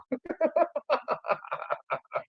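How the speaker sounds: airy high laugh tailing off; real laugh. Real, not acted. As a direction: crack up at your own comeback. Laughs at own retold comeback.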